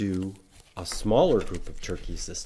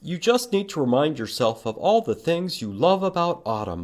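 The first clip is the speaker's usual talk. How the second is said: higher, brisk lightly voiced mom; mom suggesting; 9 semitones higher, 5 dB louder than usual. Mother coaxing Pete to love autumn, lightly voiced mom. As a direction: suggest it kindly as his mother. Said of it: "Mom suggests".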